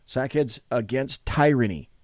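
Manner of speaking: "Tyranny" said as TIE-ranny; mispronounced Tyranny. Retold or imitated. Host repeats the actor's botched promo title, "Tyranny" as TIE-ranny. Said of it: "he mispronounces the word".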